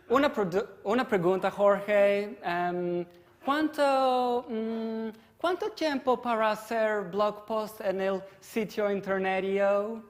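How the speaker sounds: put-on high-pitched, loud Spanish with a thick American accent. Project manager asking developer for estimate, high American-accented Spanish. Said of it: "doing it in American accent".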